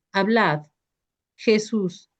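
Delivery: ordinary delivery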